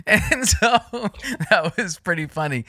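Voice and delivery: laughing. Real, not acted. Still laughing at story.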